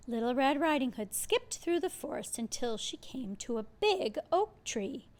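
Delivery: ordinary delivery, nothing marked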